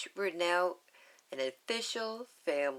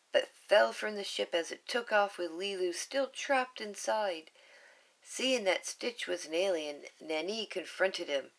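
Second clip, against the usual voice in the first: ordinary delivery, nothing marked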